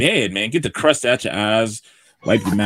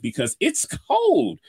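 cold drawn out high and slow; stretched cold; slower than usual. Acted. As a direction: lean into cold like it bites. Weather report, emphasis on cold.

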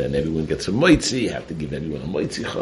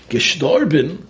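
high, louder rising Gestorben? in disbelief; shocked echo; 10 semitones higher, 6 dB louder, slower than usual. Acted. Host hearing his debtor died, high shocked question.

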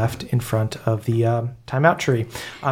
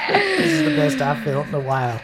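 put-on pitched-up old elf, cheered; bright little lift of joy; high happy old elf; 12 semitones higher than usual. Comforted old elf thanking the party for a grenade, pitched up.

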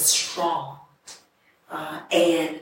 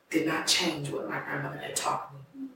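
normal talking voice

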